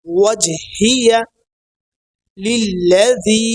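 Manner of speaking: ওয়াজহিয়া লিল্লাযী slowly, final vowel held long; correct pronunciation. Shown as a demonstration. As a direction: recite ওয়াজহিয়া, pause, then লিল্লাযী drawn out. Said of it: "উচ্চারণগুলো সহি হয়".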